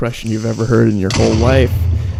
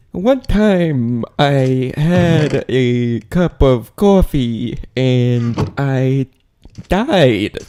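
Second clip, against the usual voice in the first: very slow, higher, halting actor impression; Christopher Walken; 3 semitones higher, much slower than usual. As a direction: stretch every word, pause, then pitch up the last. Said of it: "That's Christopher Walken".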